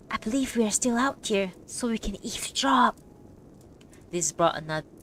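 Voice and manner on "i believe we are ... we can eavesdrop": ordinary delivery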